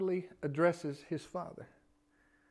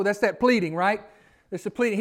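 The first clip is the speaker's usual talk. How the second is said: usual speaking voice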